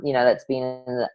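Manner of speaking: ordinary talk, nothing marked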